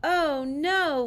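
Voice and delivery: put-on half-hearted kids' shout; mild shout. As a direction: call it out like a class of kids. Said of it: "we shout".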